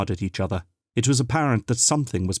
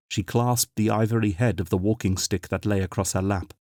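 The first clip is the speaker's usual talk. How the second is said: ordinary delivery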